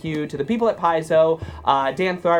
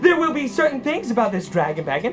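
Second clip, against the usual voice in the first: high, manic voice full of laughter; crazed prize reader; 6 semitones higher, 4 dB louder than usual. Acted. [name] reading out prizes, laughing maniacally. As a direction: read it out as if losing your mind, cackling. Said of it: "as I go crazy over here".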